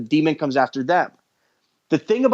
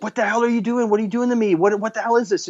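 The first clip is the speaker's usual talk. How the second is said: higher, frightened questions from the tied-up woman; panicked heroine; 6 semitones higher, faster than usual. Retold or imitated. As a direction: voice her waking up scared and demanding. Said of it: "She's like".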